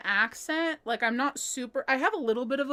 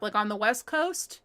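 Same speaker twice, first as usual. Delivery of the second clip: normal talking voice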